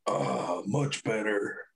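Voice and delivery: deep, slow, satisfied groan; relieved groan. Retold or imitated. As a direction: sink low into a relieved sigh. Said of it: "they make that sound".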